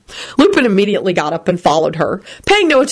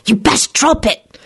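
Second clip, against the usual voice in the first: hissed warning; 6 semitones higher, slower than usual. Acted. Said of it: "hissed Elizabeth to Lupin".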